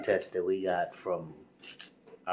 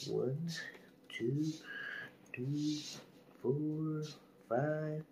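high soft counting; 7 semitones higher, 6 dB quieter than usual. Real, not acted. Counting thirty seconds softly.

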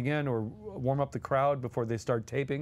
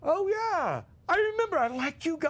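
Mel Brooks impression; 13 semitones higher than usual. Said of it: "Mel says".

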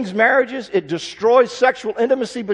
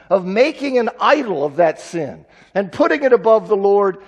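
usual speaking voice

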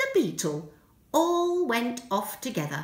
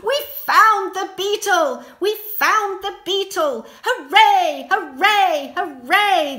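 much louder, higher joyful cheer; cheering shout; 7 semitones higher, 10 dB louder than usual. Acted. Everyone celebrating the found beetle, loud cheer. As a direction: cheer like a happy crowd. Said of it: "they shouted".

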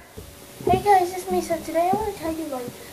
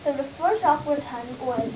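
ordinary talk

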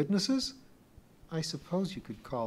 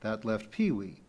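ordinary delivery, nothing marked

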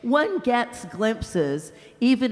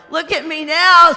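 big ghost, indignant; 6 semitones higher, 10 dB louder than usual. Acted. Big ghost shouting at the bright spirit, loud and outraged. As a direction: bellow it like an aggrieved bully.

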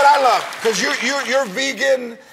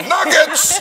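mock clean curse; 7 semitones higher, 4 dB louder than usual. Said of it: "mock clean curse".

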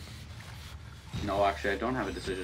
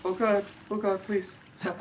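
scared pleading; 10 semitones higher than usual. Real, not acted.

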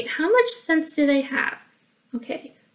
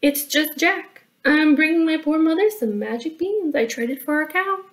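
put-on higher, innocent boy voice; young Jack; 5 semitones higher than usual. Jack explaining his errand to the troll, high boyish voice. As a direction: explain yourself as a guileless lad.

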